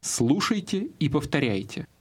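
normal talking voice

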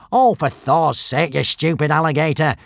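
fed up and cursing the beast; swearing. A narrator's reading. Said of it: "swore the thin prefect in Norse".

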